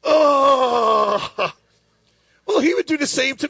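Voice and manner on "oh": loud, higher startled "Oh"; disgusted surprise. Retold or imitated. Friend tastes Orajel on his straw, loud startled outburst. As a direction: recoil at a numbing taste. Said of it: "be like".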